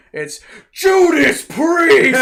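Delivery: high, slow, shouted announcement; excited shout. Retold or imitated. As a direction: belt it out like a reveal. Planetarium teacher shout.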